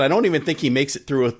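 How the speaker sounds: normal talking voice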